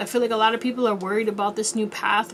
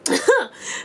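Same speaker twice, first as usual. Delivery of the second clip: high giggle burst; high laugh; 13 semitones higher than usual. Real, not acted. Laughing again.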